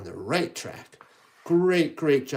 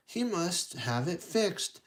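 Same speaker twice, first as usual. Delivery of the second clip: ordinary delivery, nothing marked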